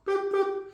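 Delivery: high soft boop boop; a little high dismissal beep; boop sound effect. Acted. Players cut from the roster, soft high boop.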